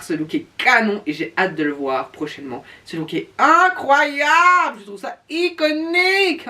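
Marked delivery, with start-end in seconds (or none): 2.9-6.5 high, slow, swooning praise; gushing. Real, not acted. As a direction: gush over it like a fangirl. Gushing over a favourite look.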